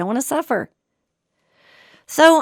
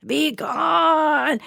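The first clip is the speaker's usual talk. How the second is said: higher, drawn-out mock scream at normal volume; 5 semitones higher than usual. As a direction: imitate a desperate scream without the volume. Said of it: "he's just screaming at it".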